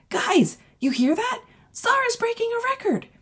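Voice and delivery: put-on girl broadcasting the news to friends; excited announcing. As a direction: shout the news to everyone, way up high. Gloria announces to other kids.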